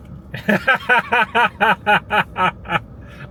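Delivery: long, high burst of rapid giggles; helpless nervous cackle at an awkward situation; laughing. Real, not acted. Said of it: "I'm laughing because that's".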